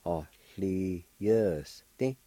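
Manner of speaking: Ahlieste slowly, in separate syllables. Shown as a demonstration. Teacher repeating the word for learners, syllable by syllable. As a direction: sound out Ahlieste beat by beat. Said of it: "It's pronounced like this".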